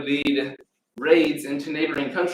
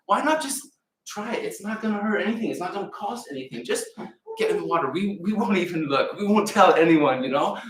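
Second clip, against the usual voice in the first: coaxing servant; 6 semitones higher than usual. Acted.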